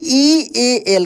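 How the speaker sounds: letters spelled out slowly; teacher spelling for the class. Shown as a demonstration. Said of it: "steel पढ़ेंगे।".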